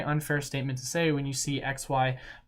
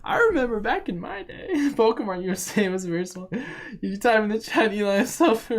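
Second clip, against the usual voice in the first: put-on frail old man voice; 9 semitones higher, 6 dB louder than usual. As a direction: read it like a shaky old grandpa. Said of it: "frail old man voice".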